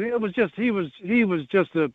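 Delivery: normal talking voice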